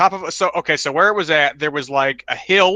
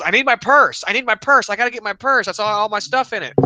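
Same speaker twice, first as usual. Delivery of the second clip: panicked lady; 7 semitones higher than usual. Retold or imitated. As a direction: imitate a frantic crash victim begging for her purse. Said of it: "she goes".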